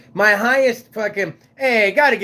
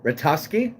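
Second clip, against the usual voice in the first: ordinary delivery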